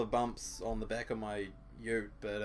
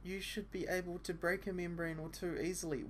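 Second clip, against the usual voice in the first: put-on higher girl's voice, stress on break; girl's line; 7 semitones higher than usual.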